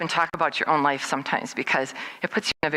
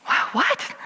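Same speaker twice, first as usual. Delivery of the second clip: stammering disbelief; 12 semitones higher than usual. Acted. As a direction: stumble into a high, baffled question. Stammering disbelief at the kitten gift.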